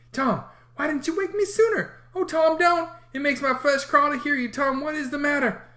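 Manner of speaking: put-on high, frightened young boy; a scared kid begging his brother. A narrator's reading. Sid frightened.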